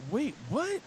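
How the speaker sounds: high-pitched mock disbelief; retold shock.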